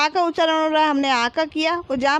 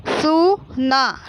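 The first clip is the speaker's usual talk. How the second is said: very slow सु, ना, held apart; stretched teaching syllables, सु then ना; much slower than usual. Shown as a demonstration. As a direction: sound out सु ना as two long pieces. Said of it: "तो ये क्या हो गया?".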